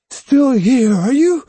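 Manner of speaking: high loud challenge; cried out. A narrator's reading. Boy challenging the fox again, loud high cry. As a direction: shout it, gripping the sword. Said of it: "he cried".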